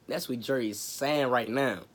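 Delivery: normal talking voice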